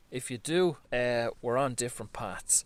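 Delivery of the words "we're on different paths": usual speaking voice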